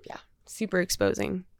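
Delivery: soft unvoiced murmur; whispering. Real, not acted. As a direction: whisper it to yourself. Murmured remark on the experience.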